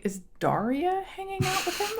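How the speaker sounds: высокий удивлённый голос; high surprised gasp. Retold or imitated. Mocking surprised reaction.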